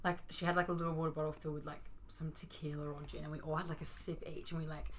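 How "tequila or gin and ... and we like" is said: soft whisper. Real, not acted. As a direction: breathe the words. Unvoiced aside.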